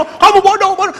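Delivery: high-pitched mimicked parental scolding; mock scolding. Son imitates his father calling him stupid, mock scolding.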